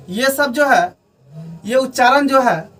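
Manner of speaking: ordinary talk, nothing marked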